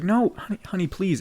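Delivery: high-pitched retold plea; imitating a fiancée begging her partner; pleading. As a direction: plead gently, voice lifted high.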